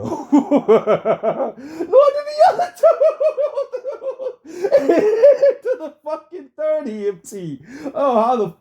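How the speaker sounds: words squeezed out through hard laughter; laughing hard. Real, not acted. Streamer cracking up at the trial footage, laughing through words.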